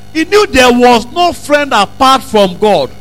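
normal talking voice

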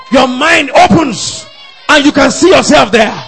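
high, loud proclamation; exhilarated preaching shout. Real, not acted. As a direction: call out the vision at full voice. Preacher builds vision to a shout.

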